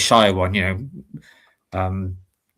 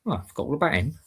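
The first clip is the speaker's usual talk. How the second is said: ordinary talk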